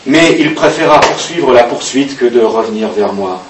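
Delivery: plain reading voice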